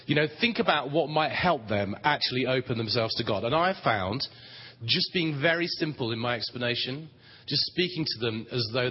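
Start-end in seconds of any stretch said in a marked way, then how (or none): none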